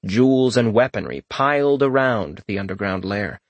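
usual speaking voice

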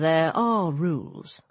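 low, slow, grave pronouncement; deep, measured god's voice; solemn god. Acted. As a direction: lay it down slowly in a low voice. Voices Anubis in dialogue.

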